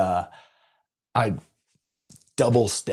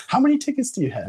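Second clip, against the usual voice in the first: much higher and fast, an amused imitated question; excited clerk; 12 semitones higher, faster than usual. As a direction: rattle it off like the giggling clerk. Laughing clerk asks.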